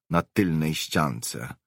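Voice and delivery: ordinary delivery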